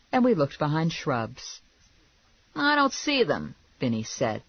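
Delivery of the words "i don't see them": high-pitched character voice; griping searcher. A narrator's reading. Man searching for the rabbit, griping. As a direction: report it in a raised man's character voice.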